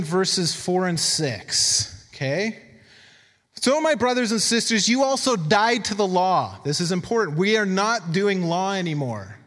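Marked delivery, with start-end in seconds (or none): 3.6-6.6 emphatic scripture reading. Acted. Preacher reads Romans to the church, emphatic scripture reading. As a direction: read the verse out with raised, deliberate weight.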